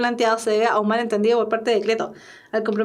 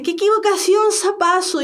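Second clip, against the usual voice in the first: high-pitched cry of dismay; dismayed exclamation; 6 semitones higher than usual. Acted. Teacher demonstrating Cleto realising his mistake, dismayed exclamation. Cleto's blunder acted again.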